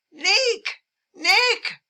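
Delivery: high, loud calling of his name; excited shout. Retold or imitated. Hat check girl spotting the husband, calls out his name loudly. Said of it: "shouted out in her foreign accent".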